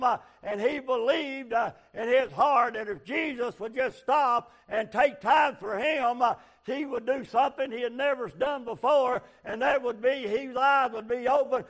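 high, loud revival preaching; full-force preaching. Acted. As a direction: preach it out to the congregation.

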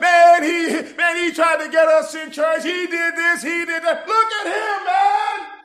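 put-on loud, high, jeering shout of the kids; mock him the way the scoffers would; preacher hammering out the scoffers' sneers. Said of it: "they'd be able to say".

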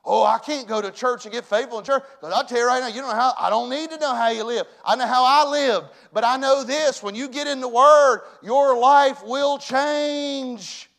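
loud, high, rousing pulpit cry; rally the congregation at full volume; full-force preaching. Real, not acted. Preacher cheering the unsaved on to come forward, full force.

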